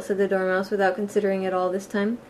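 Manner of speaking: ordinary delivery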